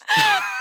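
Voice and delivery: high, loud burst of laughing; real laugh bursting out high; burst of laughter. Real, not acted. Amused at the bald-people burger campaign. (risada alta e aguda)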